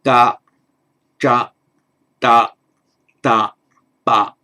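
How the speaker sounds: ga, ja, da, da, ba loud, with no puff of air; crisp unaspirated ga, ja, da, da, ba; unaspirated voiced consonants. Shown as a demonstration. Teacher modelling perfectly unaspirated sounds, loud and clear. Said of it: "a perfectly unaspirated".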